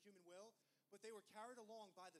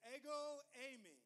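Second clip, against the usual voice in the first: proclaimed loudly; 3 semitones higher, 8 dB louder, slower than usual. Acted. Preacher proclaiming Jesus's words to the congregation, loud. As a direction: ring out Ego, eimi, louder, like a declaration.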